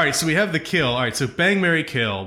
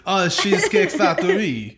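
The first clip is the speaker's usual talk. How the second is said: a hint of French, slower and higher; mock French accent; 7 semitones higher than usual. Pretending the chain is French, light French accent. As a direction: say it like it's fancy French. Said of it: "like, a French accent".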